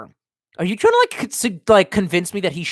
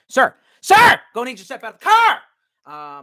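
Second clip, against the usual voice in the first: much higher, louder, screaming traffic cop; mock cop yell; 8 semitones higher, 6 dB louder than usual. Streamer playing a cop ordering a commie out, yelling. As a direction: yell like a cop at a car window. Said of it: "every time they hear me yell".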